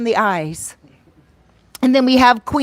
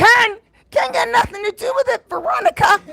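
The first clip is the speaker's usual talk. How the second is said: teasing retort; 10 semitones higher, faster than usual. Real, not acted. Teasing a commenter.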